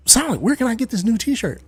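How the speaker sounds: high-pitched mock fan question; imagined eager viewer. Viewer asking streamer where to buy, mock high voice. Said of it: "you're probably saying to yourself".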